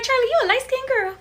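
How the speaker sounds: high mock voice of doubting viewers. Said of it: "y'all be like".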